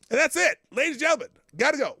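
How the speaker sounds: big, loud, high sign-off. Real, not acted. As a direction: shout the goodbye high and loud.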